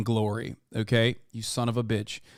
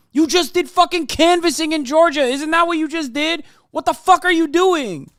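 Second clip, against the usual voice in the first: much higher and louder, incredulous rant; exasperated shouting; 14 semitones higher, 6 dB louder than usual. Real, not acted. Streamer ranting at an older rival, exasperated shouting. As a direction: yell it in disbelief at him.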